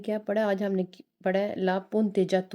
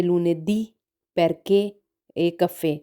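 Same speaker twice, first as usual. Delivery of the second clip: लूने दी, perché, cafe with the last syllable hit loud; teacher stressing the final accented syllable; 6 dB louder, slower than usual. Shown as a demonstration. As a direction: punch the accented end of each word. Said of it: "उसको हम जोर से अदा करेंगे".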